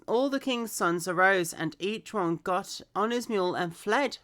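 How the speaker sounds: clear, projected story reading; scripture read-aloud. Acted. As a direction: read the Bible story out with expression.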